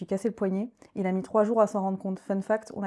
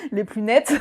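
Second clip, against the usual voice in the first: louder, amused laugh through the words; 3 semitones higher, 7 dB louder, slower than usual. Real, not acted. Guest admitting to host her dubious choice of men, laughing. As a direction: let a real laugh escape.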